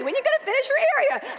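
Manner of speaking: high-pitched, fast mocking question; exasperated high imitation; nagging questioner. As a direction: mimic someone pestering you. Mimics a nagging person asking her.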